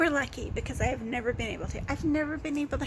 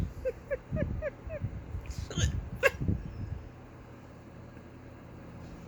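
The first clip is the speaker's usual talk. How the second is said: high breathless giggling fit; helpless giggle; 12 semitones higher, 4 dB quieter than usual. Real, not acted. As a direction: let the giggles run.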